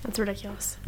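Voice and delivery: whispered disbelief. Real, not acted. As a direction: breathe it out in a whisper. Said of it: "whispered aside".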